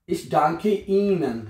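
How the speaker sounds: ich danke Ihnen drawn out word by word; very slow model. Shown as a demonstration. Teacher dictating a German thank-you phrase, very slowly. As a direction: spell it out slowly for learners. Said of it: "teacher models German phrase slowly".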